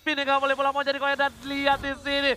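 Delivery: steady hyped esports call; fighting game announcer. Acted. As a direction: keep the call rolling. Teamfight call continuing.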